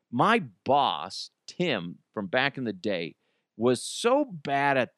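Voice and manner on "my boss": normal talking voice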